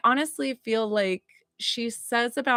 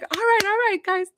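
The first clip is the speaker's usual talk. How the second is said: high, excited imitation of a bustling mom; rally the room in a much higher voice; big energy; 12 semitones higher, faster than usual. Medium voices mom greeting the group, big energy. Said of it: "came in with the biggest energy".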